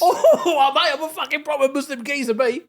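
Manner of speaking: high, hammed-up Cockney geezer; mock Cockney accent. Riffing on the workmate's accent, mock Cockney. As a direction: join in with a silly Cockney, half laughing. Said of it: "It was like a Cockney".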